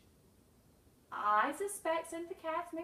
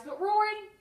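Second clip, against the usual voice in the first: excited; 9 dB louder than usual. Real, not acted. Enthusiastic praise of Rory.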